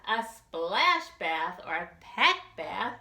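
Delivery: ordinary delivery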